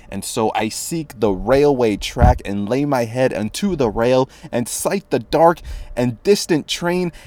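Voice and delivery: rising, theatrical recitation of a dark poem; dramatic poem reading. Acted. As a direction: read it like a doomed confession.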